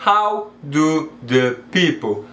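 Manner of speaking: HOW, DO, THE, PEOPLE each separate and slow; word by word. Shown as a demonstration. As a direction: split "how do the people" with a pause between words. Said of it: "mais natural do que".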